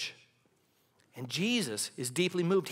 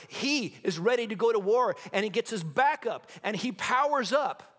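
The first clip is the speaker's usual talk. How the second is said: building preaching; 4 semitones higher than usual. Acted. Preacher rallying the congregation, building intensity. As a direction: preach it like a war horse readying for battle.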